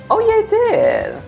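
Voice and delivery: high-pitched delighted exclamation; excited surprise. Real, not acted. Player cheers a successful catch in game, real excited surprise.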